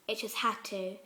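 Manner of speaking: plain reading voice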